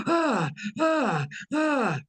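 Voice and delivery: high, panicked gasping ahs; imitating a man jolted awake in panic; breathless alarmed ah sounds; agitated gasps. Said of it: "who was very agitated and going".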